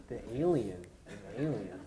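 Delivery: alien stretched and dropped low; leaning into alien. Shown as a demonstration. Describing her close-up, repeating the alien with weight. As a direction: lean into the word alien. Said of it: "Leaning into it".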